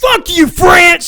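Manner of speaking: very high, loud, slow mock shout; mock patriotic shout. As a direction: yell it like a hammed-up patriot.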